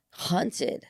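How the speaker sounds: hushed, drawn-out suspense; dramatic hush. Real, not acted. Teasing Tallulah's story at the bar, dramatically hushed. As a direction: drop soft and low on the reveal.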